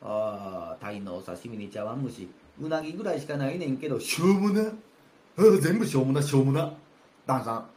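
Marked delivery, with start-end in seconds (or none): 4.0-6.7 loud, repeated scoffing; louder sneering at every dish; scornful dismissal. Acted. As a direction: wave it all away with contempt. Take dismisses the food.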